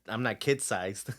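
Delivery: usual speaking voice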